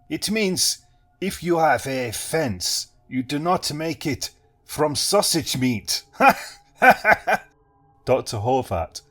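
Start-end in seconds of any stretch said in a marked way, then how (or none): 0.1-6.0 slow, higher Eastern European explanation; Eastern European accent. A narrator's reading. Hypnotist explaining his saying, Eastern European accent. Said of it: "Eastern European". 6.8-7.4 loud, high crazed peal of laughter. A narrator's reading. Hypnotist laughing at his own joke, crazed. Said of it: "exploded into peals of crazed laughter".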